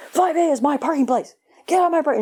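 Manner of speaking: mock irate neighbor. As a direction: snap it like a territorial neighbor. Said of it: "an irate person comes pounding".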